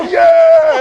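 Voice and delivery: put-on high, loud drunk "Yeah"; excited shout. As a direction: whoop it like the whole bar is in. You cheer along, drunk.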